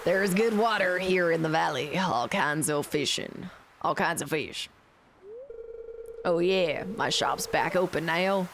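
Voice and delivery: put-on folksy old sailor accent selling his shop; fisherman's drawl. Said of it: "the accents are on par".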